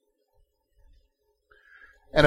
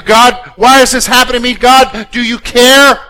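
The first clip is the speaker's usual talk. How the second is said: louder and higher, hammed-up angry plea to God; angry prayer; 8 semitones higher, 11 dB louder than usual. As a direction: rail at God in mock anger. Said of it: "Do you start asking questions out of your anger?".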